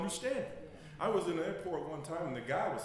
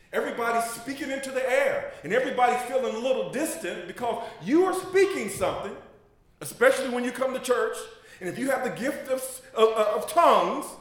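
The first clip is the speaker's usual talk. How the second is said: rising preaching intensity; 6 semitones higher, 8 dB louder than usual. Acted. Preaching builds to full force.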